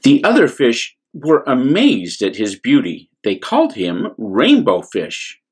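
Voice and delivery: usual speaking voice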